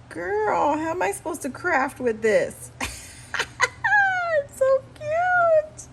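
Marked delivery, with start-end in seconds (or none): 2.8-5.9 very high, very slow squeal; overjoyed high-pitched cooing; stretched squeal. Real, not acted. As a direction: stretch the word cute into a thrilled squeak. Gushing over cute gift.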